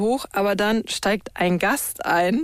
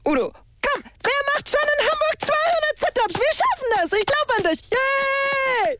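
much higher, cheering a client to finish. Real, not acted. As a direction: pump him up, end with a yay. Trainer cheers Udo on. (begeisterter Anfeuerungsruf)

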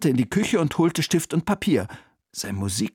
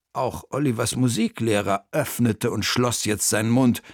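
ordinary delivery, nothing marked

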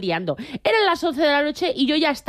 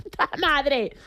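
mock outraged retort; 6 semitones higher than usual. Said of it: "como si fuera el peor insulto, le dije".

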